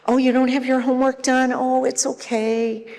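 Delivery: put-on high, cooing voice excusing a child; over-helpful rescuer. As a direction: let the child off in a high, soothing tone. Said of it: "somebody who is gonna rescue every".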